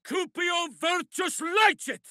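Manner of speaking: high, slow booming incantation; chanted battle magic; spell incantation. Acted. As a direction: call the spell into being.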